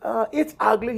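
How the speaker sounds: very high-pitched naive foreigner impression; Balky. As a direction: squeak it out like a clueless sitcom immigrant. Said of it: "Then he goes".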